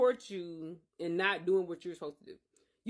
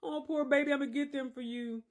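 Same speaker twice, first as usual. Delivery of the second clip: mock coddling; 6 semitones higher than usual. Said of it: "It was no sympathy".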